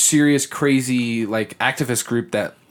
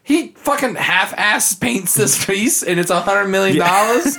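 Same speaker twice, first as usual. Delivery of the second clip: incredulous; 8 semitones higher, slower than usual. Retold or imitated. Outraged at painting price.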